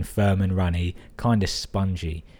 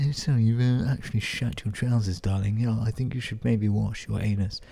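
put-on higher, apologetic voice; hesitant, high-pitched polite bloke; Jamie; 3 semitones higher than usual. Jamie gently telling his darling to wash, put-on high voice. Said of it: "he probably went".

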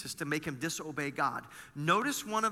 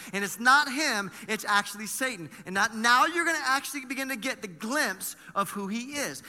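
louder and higher, driving sermon voice; preaching at full force; 4 semitones higher, 6 dB louder than usual. Acted. Pastor exhorting the congregation about Satan, full-force preaching. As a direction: preach it out to the whole room.

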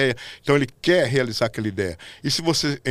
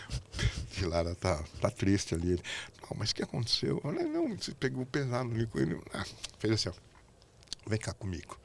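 hushed retelling; 3 semitones lower, 12 dB quieter than usual. Retold or imitated. Retelling a quiet backstage exchange.

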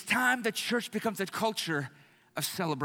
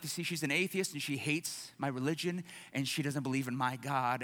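normal talking voice

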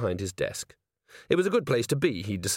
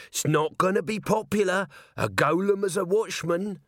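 high-pitched sceptical character voice; dubious underling, voiced high; doubtful corporal; 7 semitones higher than usual. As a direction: grumble a warning in a raised pitch.

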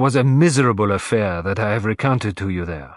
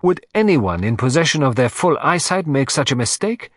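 higher and quicker, self-reproaching Poirot; animated; 5 semitones higher, 3 dB louder than usual. Acted. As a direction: burst out with animated frustration. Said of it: "becoming suddenly animated".

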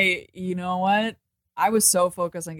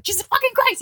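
a furious, much higher imitated cry of rage; unhinged mad outburst; faster than usual. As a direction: let Madeline snap into an unhinged, high-pitched curse. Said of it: "Like, she gets so mad".

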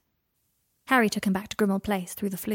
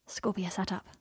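ordinary delivery, nothing marked

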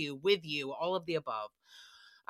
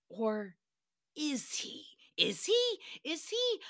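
each "is he" climbing higher; mounting suspicion; 6 semitones higher, slower than usual. Real, not acted. Host hinting the assistant is the killer, rising teasing question. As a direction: build from sly doubt to squeaky high.

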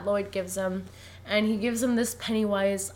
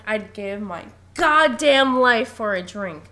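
loud, slow, emphatic grumble; mock angry; 8 dB louder, slower than usual. Podcaster acts out Jack storming down the hall, angry and loud. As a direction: imitate an angry man, hitting the words hard. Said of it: "all angry".

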